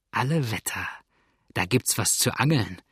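ordinary delivery

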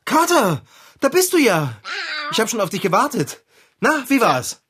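hoch und laut rufen; begrüß den heimkehrenden Kater voller Neugier; freudige Begrüßung. Acted. Freudige Begrüßung bei Rückkehr. (hohe, laute, freudige Begrüßung)

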